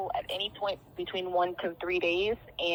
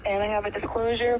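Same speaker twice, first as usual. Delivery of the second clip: ordinary talk, nothing marked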